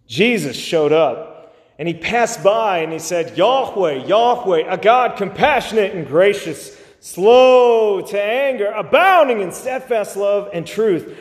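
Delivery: impassioned preacher at full voice; fervent preaching. Real, not acted. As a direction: proclaim it with urgent conviction.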